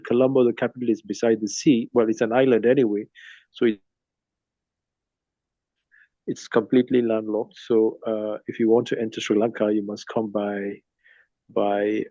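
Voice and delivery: usual speaking voice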